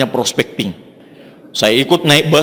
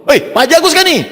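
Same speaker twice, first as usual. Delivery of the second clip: much higher, urgent call to Haji Agus; panicked phone call; 13 semitones higher, faster than usual. Retold or imitated. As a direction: blurt it out in a rush like a frantic friend. Friend calls in panic.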